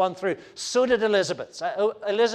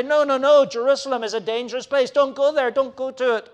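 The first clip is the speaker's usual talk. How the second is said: higher, louder, quick warning plea; urgent pleading; 8 semitones higher, 7 dB louder than usual. Acted. Friends warning Paul off Jerusalem, urgent pleading. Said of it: "there his friends, including his good friend Luke, say".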